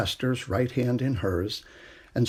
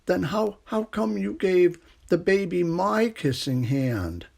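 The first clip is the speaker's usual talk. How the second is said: higher, hurt little raccoon voice; upset child; 7 semitones higher than usual. A narrator's reading. Chester questions his mother, higher, hurt and pleading. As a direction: plead like a small wounded child.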